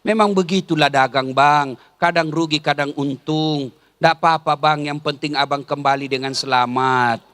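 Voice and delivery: wife soothing her husband after a bad day; gentle consoling wife.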